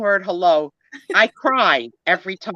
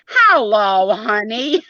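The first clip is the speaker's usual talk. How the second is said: drawn-out, loud mimicked hello; imitated greeting; 2 semitones higher, 3 dB louder, much slower than usual. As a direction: stretch the hello like an impersonation. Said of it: "say it to each other every morning".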